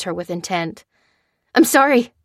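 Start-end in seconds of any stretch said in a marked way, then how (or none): 1.5-2.1 raised anguished apology; crying out. Acted. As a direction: cry it out, higher and louder. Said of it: "she cried".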